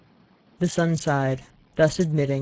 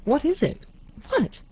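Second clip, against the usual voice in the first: alarmed; 11 semitones higher than usual. A narrator's reading. Startled princesses at the door.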